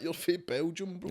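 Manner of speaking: mock someone's put-on American accent. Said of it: "in the interview with American accent".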